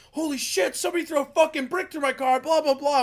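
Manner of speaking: high-pitched mock freakout over a smashed car window; mock panic. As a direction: voice jumped high, shocked and alarmed. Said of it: "he's like".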